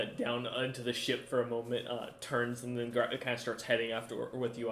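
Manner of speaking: ordinary talk, nothing marked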